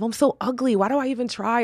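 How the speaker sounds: put-on the teen girl voice, not her own; imitating a young girl. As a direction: mimic a teen girl putting herself down. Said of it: "overheard them say".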